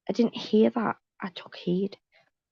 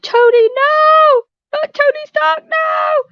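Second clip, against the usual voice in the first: high, loud, slow anguished wail at the screen; mock sobbing wail; 12 dB louder, slower than usual. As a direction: ham up a fan crying over a movie death. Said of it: "And I cry, I'm like".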